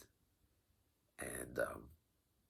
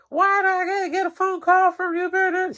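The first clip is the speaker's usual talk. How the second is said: much higher, louder mock scolding lady; imitating the yelling lady; 9 dB louder than usual. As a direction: squeal like an angry office lady telling kids off. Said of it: "She's yelling at the kids".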